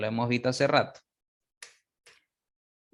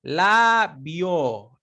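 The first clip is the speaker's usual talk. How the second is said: Labio said louder with a strong open vowel; model word; 3 semitones higher, 4 dB louder than usual. Shown as a demonstration. Teacher modelling vowel strength. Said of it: "la O se abre más".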